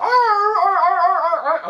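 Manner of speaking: high, loud barking seal imitation; mock seal barking that comes out like a dog; seal barks. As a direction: bark like a seal, arr arr. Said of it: "that's more like a dog, but imagine it sounds like a seal".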